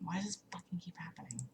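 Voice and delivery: breathless, fast whisper to herself; whispered frustration. Real, not acted. Frustrated streamer fighting the echo, fast whispered grumble. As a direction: hiss it under your breath, annoyed.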